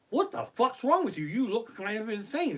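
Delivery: high-pitched, lightly mocking passer-by; hammed-up incredulous quote; mock outrage. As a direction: imitate a stranger's incredulous challenge. Mimics passers-by judging mask wearers.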